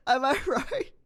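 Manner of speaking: high, laughing question; real laughter. Real, not acted. Exam taker checking their Japanese attempt, cracking up. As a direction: ask it while cracking up.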